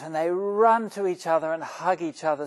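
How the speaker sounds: usual speaking voice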